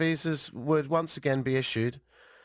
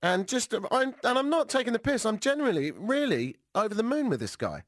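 ordinary talk

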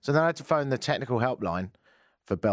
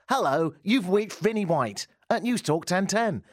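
higher, recited voicemail greeting; reanimated answerphone greeting; 7 semitones higher than usual. Retold or imitated. Faking an answer phone for the helpline, animated recorded-greeting voice. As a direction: pretend to be your own recorded message. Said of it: "I even reanimated my voice".

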